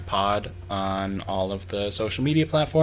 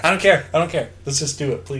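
higher, louder mock begging; pleading insistence; 4 semitones higher, 5 dB louder, faster than usual. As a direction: beg them over every objection, hammed up. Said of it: "Just be like".

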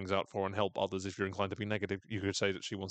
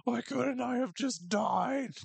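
high, slow, whiny mock sob; mock sobbing; 9 semitones higher, slower than usual. Sobbing coach wishes he died, high slow mock wail. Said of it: "Coach is sobbing".

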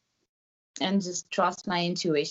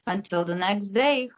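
ordinary talk

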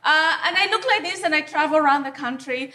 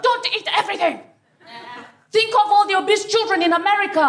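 mild mock shout; 5 semitones higher than usual. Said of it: "give it to me and shout".

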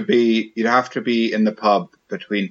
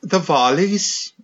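slow, lilting Welsh, pitched up; Welsh accent; 5 semitones higher, slower than usual. Shown as a demonstration. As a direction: put on a drawn-out Welsh accent. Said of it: "I can do Welsh perfect".

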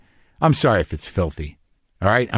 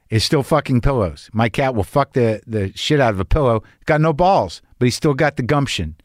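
ordinary talk, nothing marked

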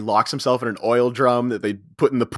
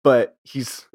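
normal talking voice

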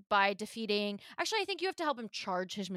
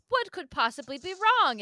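a louder, higher mock worried question; hammed-up puzzled question; mock concern; 8 semitones higher, 6 dB louder than usual. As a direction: ask it with put-on alarm. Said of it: "You're like".

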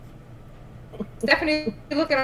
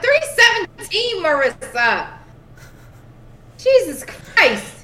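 high, loud exasperated outburst; 9 semitones higher, 6 dB louder, slower than usual. Real, not acted. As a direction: cry out in disbelief at a friend. Exasperated at co-player's question.

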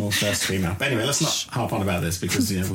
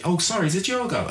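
high Irish voice apologising; a higher, chatty Irish ad aside; Irish ad banter; 9 semitones higher than usual. Retold or imitated. Host voicing ad chatter in higher Irish lilt. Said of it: "they'd sort of talk to each other".

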